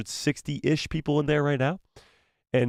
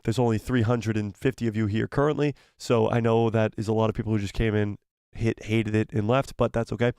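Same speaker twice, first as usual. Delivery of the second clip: usual speaking voice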